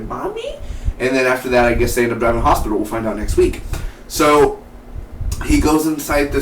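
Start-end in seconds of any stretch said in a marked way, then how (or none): 0.0-0.5 high, soft mock little voice; imitated child. Child calling to collapsed mom, high soft mock voice. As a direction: squeak it softly, much higher. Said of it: "and the mom goes, she's like".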